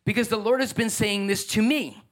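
ordinary talk, nothing marked

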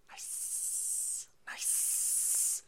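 soft whispered nice. Real, not acted. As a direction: breathe it almost silently. Whispered reaction.

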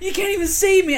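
high and loud, laughing through it; mock scornful retort. Friend points out the obvious on phone, mock exasperated. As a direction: imitate an incredulous friend, amused.

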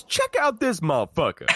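high-pitched Pulp Fiction impression; mock yell, pitched high without real volume; Sam Jackson. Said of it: "He talks like Sam Jackson".

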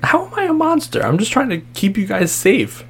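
high-pitched, wounded self-defence; indignant protest. Acted. Accused, defends herself as not a monster.

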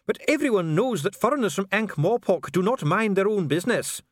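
high-pitched, agitated outburst; nerve cracking. A narrator's reading. Official rebukes foreigners from Ankh-Morpork, nerve cracking. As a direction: lash out as composure fails. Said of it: "his nerve cracking".

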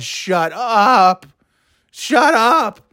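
high-pitched, fed-up outburst; exasperated shut-up. Retold or imitated. Man reacting to screaming kids, exasperated. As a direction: raise the pitch sharply, utterly fed up.

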